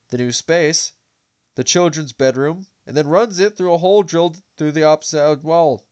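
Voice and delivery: steady, measured audiobook read; story narration. A narrator's reading. Reads novel aloud to viewers.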